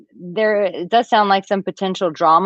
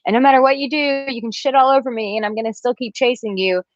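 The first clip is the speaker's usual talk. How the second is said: higher, pleading mock whine; needy nice guy; 7 semitones higher than usual. Mocking a needy guy who keeps chasing a woman. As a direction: play the clingy friend-zone guy, a bit mocking. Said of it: "eh, it's needy".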